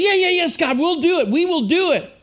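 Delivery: hammed-up gushing agreement; mock eager promise. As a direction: play the overly keen believer who won't follow through. Mocking eager empty promise to God.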